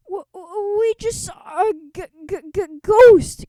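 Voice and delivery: stammering in panic, high, ending in a loud yelp; a scared boy who can barely get the word out; terrified stammer building to a shout; frightened stutter. Acted. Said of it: "cried George".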